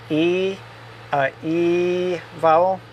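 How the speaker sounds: E vowel said as a pure Spanish ee. Shown as a demonstration. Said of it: "right in the front top part of your mouth".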